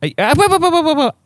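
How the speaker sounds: high, rushed wait wait; urgent. Real, not acted. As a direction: stop them in a hurry. Rushing to stop recording.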